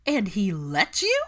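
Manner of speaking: high, loud, slow disbelief; astonished. A narrator's reading. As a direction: stretch out the shock on "what". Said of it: "Pansy gaped at her".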